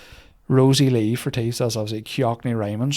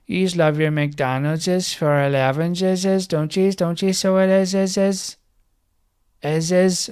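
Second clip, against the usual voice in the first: higher-pitched mock local lads; wee guys; 6 semitones higher, 3 dB louder, slower than usual. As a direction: imitate the wee guys, stretching every ending. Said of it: "like the wee guys who go".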